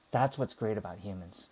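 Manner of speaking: normal talking voice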